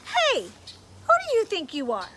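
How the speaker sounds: loud, stern scolding voice; a bossy inner voice catching him out; stern conscience. Acted.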